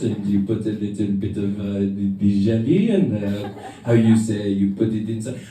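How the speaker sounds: low-pitched French friend impression; halting explanation in a thick French accent. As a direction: put on a deep Frenchman's voice, searching for words. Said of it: "he loses his French accent".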